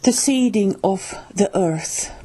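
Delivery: plain reading voice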